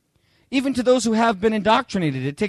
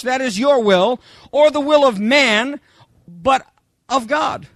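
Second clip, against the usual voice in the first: ordinary delivery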